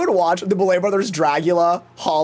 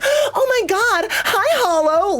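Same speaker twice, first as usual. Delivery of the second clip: much higher, slow fake fan gush; mock gushing greeting; slower than usual. Mocking a fake friendly greeting to Hollow, gushing and high. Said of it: "I'm not gonna be like".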